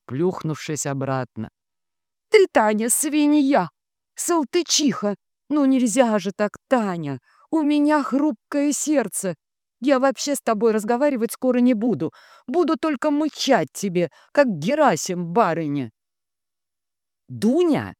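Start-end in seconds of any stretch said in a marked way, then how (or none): 2.3-15.9 high, aggrieved scolding; high-pitched offended tirade; wounded, cranky Dunya. A narrator's reading. Dunya scolds after needle jab. 17.3-17.9 stern raised call of a name; strict, higher-pitched reprimand. A narrator's reading. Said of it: "строго говорит ей Татьяна".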